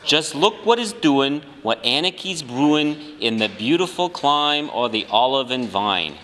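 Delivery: lightly hammed-up Southern accent that sounds off; attempted Southern accent. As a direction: try for a Southern senator and land in Brooklyn. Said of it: "Now I sound wrong".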